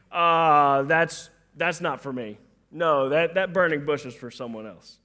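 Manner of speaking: reluctant excuse. Pretend. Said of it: "we have the nerve to say".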